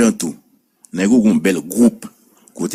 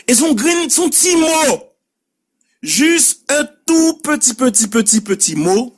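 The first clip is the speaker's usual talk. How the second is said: voix montée très haut, lente, sur « petit, petit »; étirer chaque « petit » pour souligner la petitesse; insistance aiguë. Shown as a demonstration. (insistance aiguë et traînante sur « tout petit mot »)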